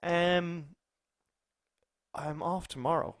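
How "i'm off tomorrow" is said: ordinary delivery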